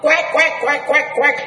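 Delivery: mock duck quacks, high; duck quacking. Preacher mimicking the duck his mother became, high quacks.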